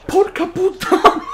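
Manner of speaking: high laughing swear; laughing curse. Real, not acted.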